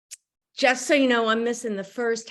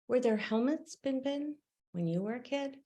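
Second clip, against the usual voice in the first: quick, softer hint of a child's question; lightly voiced grandchildren; 3 semitones higher, 6 dB quieter, faster than usual. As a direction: lightly imitate curious granddaughters, quick and soft. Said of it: "My grandgirls ask again".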